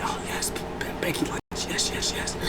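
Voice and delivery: breathless whispered glee; voiceless, giddy whisper of joy; excited whisper. Retold or imitated. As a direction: hush it out like a thrilled secret. Hyped Bianca quoted, voiceless.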